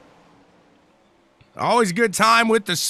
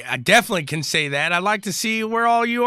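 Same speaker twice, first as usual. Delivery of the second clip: ordinary talk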